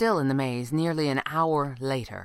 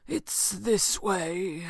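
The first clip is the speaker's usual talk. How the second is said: very slow, drawn-out stranger's voice; assured mysterious stranger; 4 semitones higher, much slower than usual. A narrator's reading. Stranger directs companions to the right, slow and deliberate. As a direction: stretch every word, sure of the way.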